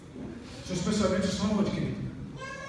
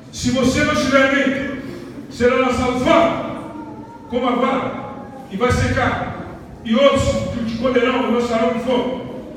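preaching scripture; 4 semitones higher, 6 dB louder than usual. Acted. Scripture declaimed.

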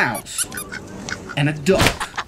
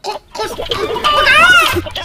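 very high clucks, last one loud; chicken clucking; 4 dB louder, much slower than usual. A narrator's reading. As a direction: cluck like a hen. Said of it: "said Chicken".

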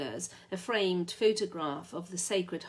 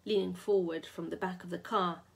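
ordinary delivery, nothing marked

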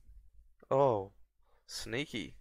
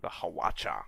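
whisper; 6 semitones lower than usual. Real, not acted. Unit name, whispered.

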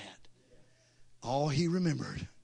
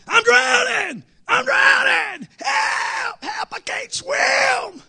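high, loud, desperate screaming for help; panicked scream; 10 dB louder, much slower than usual. Retold or imitated. Preacher acting out the fisherman screaming in the water. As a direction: scream like a man drowning. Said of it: "he was screaming".

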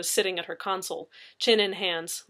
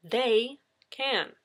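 They stressed, high then falling; emphasis on They; 5 semitones higher than usual. A narrator's reading. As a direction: hit They hard. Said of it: "putting heavy emphasis on the pronoun".

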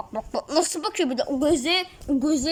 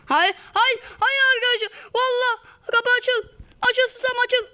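frantic pleading; 11 semitones higher than usual. Real, not acted. Gamer begging the stuck door to open, frantic and high. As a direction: yell at the door to open, pitch way up.

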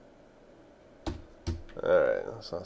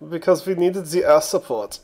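higher, louder mock German accent; bad German accent; 8 semitones higher, 11 dB louder than usual. Gamer still joking about air support, loud mock German accent. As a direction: ham up a German officer's complaint in a German accent.

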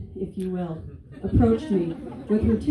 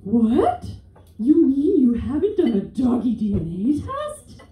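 shocked, slightly loud question; aghast mom; 4 semitones higher, 3 dB louder than usual. Retold or imitated. The Florida mom appalled she skipped a dog DNA test. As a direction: gasp it like a horrified mom. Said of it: "she was aghast".

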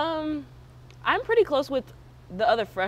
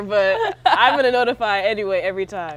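laughing; 10 dB louder than usual. Real, not acted. Laughing while eating candy.

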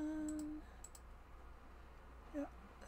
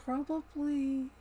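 a lightly German probably, louder with a rolled r; mild German-accented probably; Stanley Tucci German accent; 3 semitones higher, 4 dB louder than usual. Retold or imitated. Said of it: "whose accent that I am probably copying".

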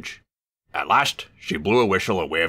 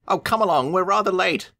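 higher engine voice; Thomas calling. A narrator's reading. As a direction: sing out the hurry call. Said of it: "he'll call".

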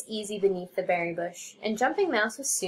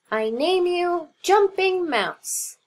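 higher, slow ceremonial voice; solemn naming; 8 semitones higher, 4 dB louder, slower than usual. Acted. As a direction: bestow the name slowly and grandly. Frog bestows a name.

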